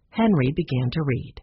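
ordinary delivery

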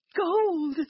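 high, loud joyful shout; excited cry. A narrator's reading. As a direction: cry out with glee. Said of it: "cried Jack's mother".

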